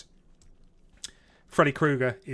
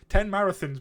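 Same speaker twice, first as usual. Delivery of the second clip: higher and louder, laughing through the words; laughing while talking; 6 semitones higher, 6 dB louder than usual. Real, not acted. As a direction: laugh it out at your own joke. Joking about running marathons.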